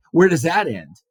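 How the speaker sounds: mocking scoff. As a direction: toss it off like a dismissive husband. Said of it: "John sort of laughs at her".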